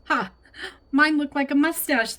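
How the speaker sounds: high, loud playful exclamation; gleeful joking. A narrator's reading. Lena shows grandma her grape-leaf mustache, gleeful.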